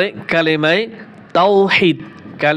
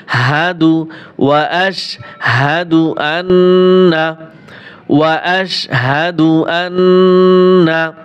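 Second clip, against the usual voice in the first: slow Quranic recitation; 3 dB louder, much slower than usual. Shown as a demonstration.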